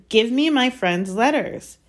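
slow, firm angry demand. Acted. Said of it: "said Harry angrily".

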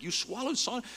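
high, loud incredulous repeat of swallowed sawdust; astonished question. Retold or imitated. Speaker repeating the stranger's words, astonished and high. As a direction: squeak it out in disbelief.

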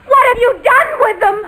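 put-on shrill high accusing cry about the children; accusing mock shout. [name] accuses George about the children, high mock shout. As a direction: demand it like a frantic mother.

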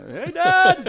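mock shout of Dad to his mentor; playful yell. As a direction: holler to annoy the boss. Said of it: "I'd scream across the whole bullpen".

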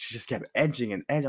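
ordinary talk

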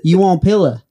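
imitated Southern accent. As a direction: mimic her Southern accent, insistently. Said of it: "a Southern accent just does not make sense".

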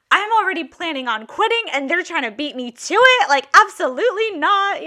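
high-pitched indignant outburst, imitated; affronted, voice jumping up; retold indignation. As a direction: act out the outraged friend. Friend reacts to planned firing.

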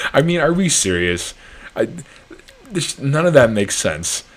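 can't believe what he just read; incredulous disbelief. Real, not acted.

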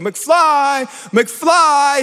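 loud, high, mocking bully calls; bully taunt. Biff bullying McFly at the diner, loud taunting. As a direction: bark the name like a bully knocking his head. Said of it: "He's like".

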